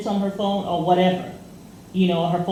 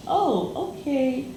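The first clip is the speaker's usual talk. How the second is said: startled realization; 6 semitones higher, slower than usual. Retold or imitated. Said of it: "she recognized".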